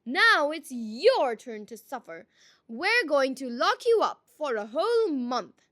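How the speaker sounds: loud angry threat. A narrator's reading. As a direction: threaten him, loud and furious. Angry villagers threaten Malice.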